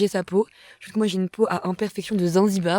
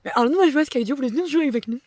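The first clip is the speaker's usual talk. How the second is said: higher, louder eager invite; eager invitation; 6 semitones higher, 4 dB louder, faster than usual. Retold or imitated. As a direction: mimic an eager friendly invitation. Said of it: "Julien, sur un élan de bonté".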